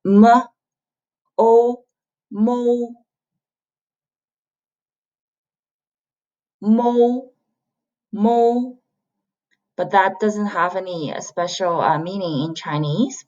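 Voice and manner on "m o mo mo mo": MO sounded out slowly as M, O, then blended; syllable demo. Shown as a demonstration. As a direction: model the blend MO, held on a level note. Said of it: "you can pronounce this".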